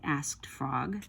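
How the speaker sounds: ordinary delivery, nothing marked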